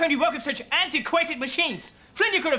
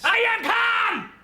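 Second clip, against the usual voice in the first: angry shout; 7 semitones higher, slower than usual. Acted. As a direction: yell back that you're calm. Told to calm down, explodes.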